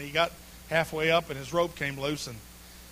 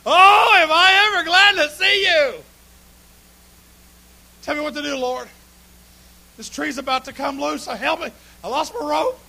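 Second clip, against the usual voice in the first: relieved pleading; 9 semitones higher, 11 dB louder than usual. Acted. Climber relieved to see Jesus, high pleading cries. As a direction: beg the Lord to save you.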